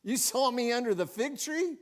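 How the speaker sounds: mildly astonished, rising into the question; startled amazement. Acted. Nathanael realises Jesus saw him under the tree, mildly startled. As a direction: play a touch of startled disbelief. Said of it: "it startled him".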